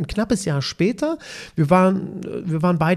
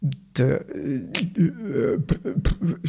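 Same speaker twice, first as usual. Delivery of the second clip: sputtering false starts, imitated; mock tongue-tied stutter on d and p; stammering; 3 dB quieter, much slower than usual. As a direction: get stuck on the first consonant, lost for words. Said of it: "stammelte ich das zweite Mal rum".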